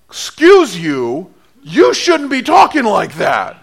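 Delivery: loud, high, slow indignant scolding; indignant retort. Retold or imitated. Preacher scolding Peter's gall, loud indignant retort. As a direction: put him in his place, loud and high.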